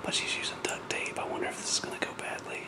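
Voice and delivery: whisper. Real, not acted. Sneaking duct tape onto dog.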